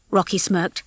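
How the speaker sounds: usual speaking voice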